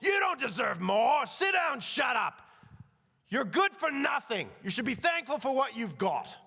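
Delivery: loud, high-pitched outraged master; explosive acted scolding at full voice; flipping out. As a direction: shout down the cheeky orphan. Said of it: "the guy flips out on him".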